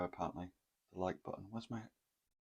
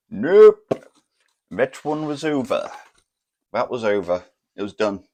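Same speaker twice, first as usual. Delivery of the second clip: much louder, higher, emphatic nope; loud punchline; 4 semitones higher, 14 dB louder than usual. Real, not acted. Telling the crowd whether he got another date, a loud emphatic nope. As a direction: slam the door on it.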